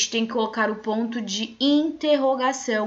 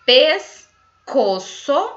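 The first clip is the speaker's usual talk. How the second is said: slow dictation word; 4 semitones higher, 6 dB louder, much slower than usual. Shown as a demonstration. Teacher reading the missing syllables: slow loud dictation. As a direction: stretch pescoço slowly, higher and louder. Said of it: "Então eu vou ler".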